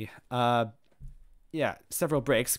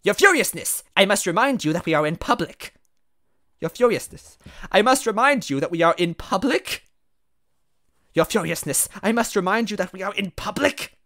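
higher-pitched formal character voice; raised, fussy reminder to the boss; admonishing underling; 7 semitones higher, 8 dB louder than usual. Acted. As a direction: urgently remind a superior to behave.

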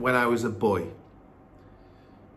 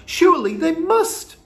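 high, loud plea; boy's raised protest; crying out; 7 dB louder than usual. A narrator's reading. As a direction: insist desperately, voice pitched way up. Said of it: "I cried".

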